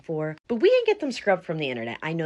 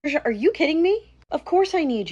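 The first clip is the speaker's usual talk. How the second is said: loud, high exasperated snap; angry outburst; 10 semitones higher, 6 dB louder than usual. Acted. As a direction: blast her for asking to skip. Father outraged at daughter.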